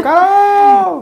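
"Carol!" yelled high and loud; mock shout. Partner shows a driver yelling to Carol, pretend shout. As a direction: call out across the road. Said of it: "ele grita".

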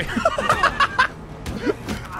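high wheezing cackle; helpless high-pitched laughing fit. Real, not acted. Breaking things in game, laughing.

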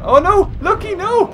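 high, loud cry of dismay; mock distressed cry. Owner calling to pet dinosaur Lucky, mock distressed cry. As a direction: call out in playful horror.

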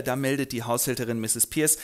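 normal talking voice

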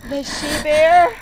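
loud fearful cry of the she bear; frightened cry. Acted.

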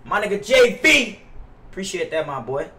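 ordinary talk

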